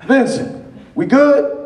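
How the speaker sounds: high, loud hitman impression; Samuel L. Jackson. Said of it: "he starts talking to Travolta".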